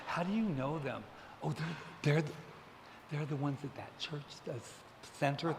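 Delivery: soft, halting imitation of puzzled onlookers; hushed retold gossip. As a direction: act out people murmuring about outsiders. Retelling a table's puzzled gossip.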